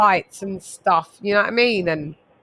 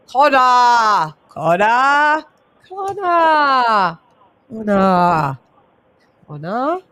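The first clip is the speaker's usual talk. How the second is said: drawn-out calls, louder at first; mock exasperated calling; 3 dB louder, much slower than usual. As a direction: call the name out slowly, letting it tail off.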